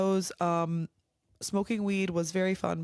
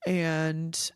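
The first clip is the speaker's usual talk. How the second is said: normal talking voice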